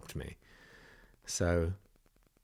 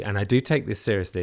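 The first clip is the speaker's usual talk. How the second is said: ordinary delivery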